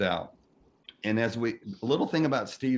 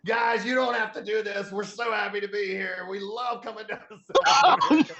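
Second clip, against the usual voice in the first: higher, loud rock star greeting fans; Steven addressing crowd; 9 semitones higher, 4 dB louder than usual. Retold or imitated. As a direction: call out to the whole plane, grand and happy.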